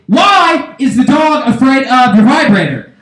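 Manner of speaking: high, slow accusing question; demanding husband. Acted. Husband confronting his wife, high demanding question. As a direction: call it out with a drawn-out "Why". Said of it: "I say".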